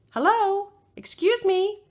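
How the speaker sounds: high calling shout across the water; shouting to a fisherman on the lake. Acted. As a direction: hail a fisherman from a boat. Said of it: "Kayla shouts to a fisherman".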